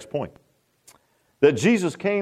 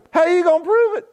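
higher, louder, mocking disbelief; incredulous challenge; 12 semitones higher, 4 dB louder than usual. Real, not acted. Preacher mocking pet psychics, incredulous challenge. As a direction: dare them to prove it. Challenging dog whisperer claims.

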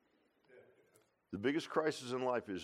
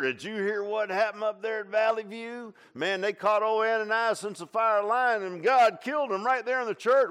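gossiping townsfolk; 9 semitones higher, 10 dB louder than usual. Retold or imitated. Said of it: "when they heard".

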